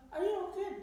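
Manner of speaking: high-pitched, alarmed question; concerned broker. Retold or imitated. Broker finding a woman screaming for help, high concerned voice. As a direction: act out a passer-by's worried check. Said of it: "Akhirnya dia bilang".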